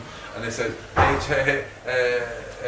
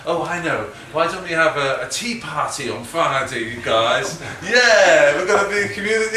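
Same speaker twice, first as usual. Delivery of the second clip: higher and louder, chirpy put-on voice, speeding up at the end; mock cheery prison staff; 4 semitones higher, 7 dB louder than usual. Mocking the prison's community slogan, chirpy put-on voice. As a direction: send up a twee community organiser. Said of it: "it's like they're all having a".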